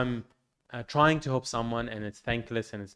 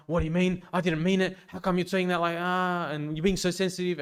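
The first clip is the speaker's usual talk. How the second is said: defensive attack; 6 semitones higher than usual. Retold or imitated. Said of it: "attacking them".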